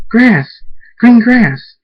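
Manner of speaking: high, loud cry of joy; shouting with delight; excited shout. Acted. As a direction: yell it like you've spotted paradise. Brady spots grass after long trip.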